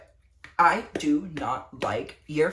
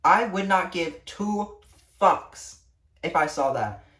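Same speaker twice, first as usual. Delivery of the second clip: normal talking voice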